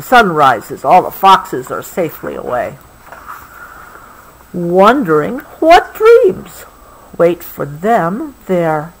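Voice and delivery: gentle, unhurried storybook read; reading to a child. Acted. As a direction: soothe a child toward sleep with the story. Reading the book slowly.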